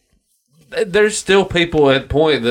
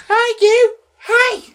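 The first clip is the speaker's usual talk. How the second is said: put-on very high, squeaky old lady crying out after her cart; hammed-up granny calling after the hoodlums; old British lady; much slower than usual. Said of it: "and she's like".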